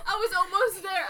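normal talking voice

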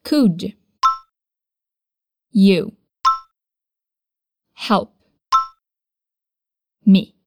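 each word alone with long pauses. Shown as a demonstration. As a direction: split it into single words for practice. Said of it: "Vamos praticar isso também".

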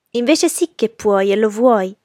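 loud, slow, haughty contradiction; haughty and deliberate; arrogant declaration. A narrator's reading. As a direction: declare it slowly with arrogant certainty. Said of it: "dichiarò Blanche in tono arrogante".

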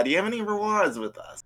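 high-pitched Boston cashier greeting; Bostonian accent. Shown as a demonstration. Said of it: "using a Bostonian accent at the register".

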